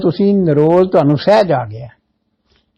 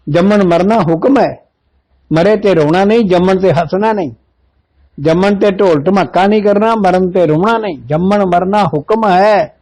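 fervent preaching at a raised pitch; rising preaching; 4 semitones higher than usual. Acted. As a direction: drive the teaching home. Preacher rises to full force. (ਜੋਸ਼ ਨਾਲ ਕਥਾ)

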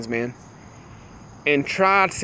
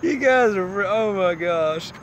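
trying to talk while cracking up; high start, slowing as laughter winds down; breaking off, overcome with laughter; laughing hard; 3 semitones higher, slower than usual. Real, not acted. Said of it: "my stomach hurts. I love so hard".

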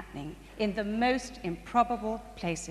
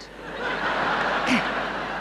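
breathy amused laugh at himself; a light, amused chuckle at the story; 11 semitones higher than usual. Real, not acted. Laughs at own remark.

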